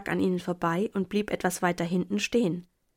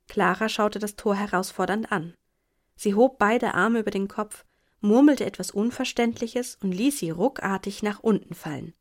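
ordinary delivery, nothing marked

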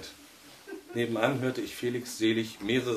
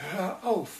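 "Hör auf" much higher, squeezed out, no longer shouted; strained voice; 10 semitones higher than usual. A narrator's reading. Husband begs the radio to stop, strained and pressed. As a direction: force "Hör auf" out through a tight throat. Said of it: "sagte Felix mit gepresster Stimme".

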